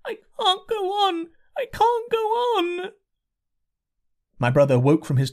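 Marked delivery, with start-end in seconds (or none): 0.0-2.9 put-on high, slow, tearful little girl's voice; a worn-out child sobbing she can't walk on; weeping child. A narrator's reading. Lost little girl under the hedge, high slow weeping.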